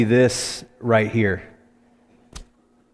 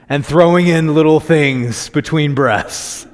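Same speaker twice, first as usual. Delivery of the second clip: laughing breathlessly; 5 semitones higher, 6 dB louder, slower than usual. Retold or imitated. Breathless on the run.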